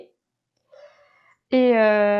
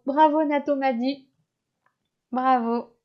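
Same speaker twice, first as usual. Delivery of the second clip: usual speaking voice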